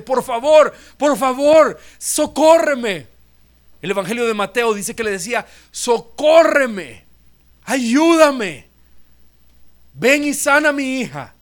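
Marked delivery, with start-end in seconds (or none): 0.0-3.1 much higher, louder and drawn-out begging; a desperate mother pleading with Jesus for help. Acted. Woman pleading insistently. 5.7-11.3 much higher, louder and drawn-out begging; a desperate mother pleading with Jesus for help. Acted. Mateo quotes the woman begging.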